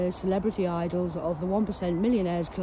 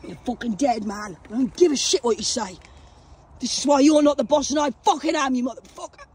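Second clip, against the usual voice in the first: loud, raised-pitch outburst at Satan; angry cursing; 5 semitones higher, 6 dB louder than usual. Real, not acted. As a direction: curse the devil out in real anger. Cursing out Satan after coughing fit.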